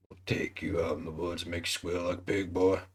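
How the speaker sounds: lurk in the woods and threaten; creepy backwoods hillbilly. Pretend. Picturing the suspect's face saying it, a low hillbilly threat. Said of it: "Now picture it saying".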